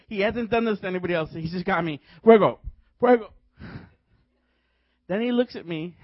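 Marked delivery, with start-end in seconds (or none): 2.2-3.3 loud, high punched-out cries; imitated preacher's shout. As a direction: mimic a minister firing off commands. Imitates minister's shouted command.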